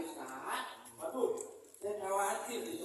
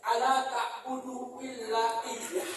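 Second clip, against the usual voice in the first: Quran recitation; 4 semitones higher, 7 dB louder, slower than usual. Acted. Reciting Quran verse slowly.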